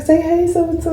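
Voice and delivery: high coaxing mom voice; mom prompting child. Retold or imitated. Mom prompting her toddler to greet the nurse, high coaxing voice. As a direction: coax the toddler to say hi.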